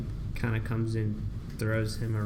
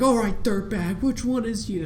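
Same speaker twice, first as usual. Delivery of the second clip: raised, louder imitated interrogation; mock tough cop; 8 semitones higher, 4 dB louder than usual. Cop questioning Riddler over IDs, raised mock-tough demand.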